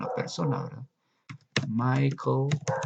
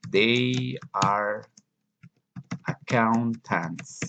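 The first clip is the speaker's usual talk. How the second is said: muy lento y claro; slow model reading; much slower than usual. Shown as a demonstration. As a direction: dictado lento para la clase. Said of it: "modelo lento para alumnos". (lectura modelo muy lenta)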